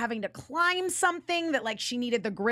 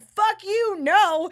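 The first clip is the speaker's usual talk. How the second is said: sudden loud, higher defiant yell; defiant outburst; 6 semitones higher, 7 dB louder, much slower than usual. Retold or imitated. Usagi refusing to stay behind, loud defiant yell. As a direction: shout it back in refusal.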